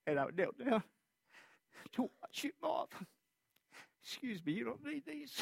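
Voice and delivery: tearful. Real, not acted. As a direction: fight the tears as you speak. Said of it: "I don't know why I'm weeping".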